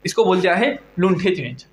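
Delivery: normal talking voice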